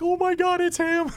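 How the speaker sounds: very high, overwhelmed fan squeal, imitated; mock tearful-fan excitement. Fan re-enacting the museum scene, high excited squeal. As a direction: squeal it like a fan seeing a hero. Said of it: "and you're like".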